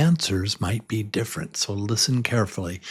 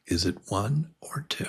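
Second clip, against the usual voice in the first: whisper; 9 dB quieter than usual. Real, not acted. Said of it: "quiz suspense whisper".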